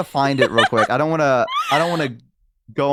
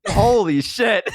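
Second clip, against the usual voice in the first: laughing; 7 semitones higher, slower than usual. Real, not acted. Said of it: "laugh heard".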